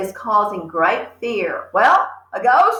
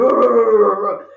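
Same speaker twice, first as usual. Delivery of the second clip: dog growl; 6 dB louder than usual. Retold or imitated. Teacher showing a big dog growling at you. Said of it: "huge dog goes".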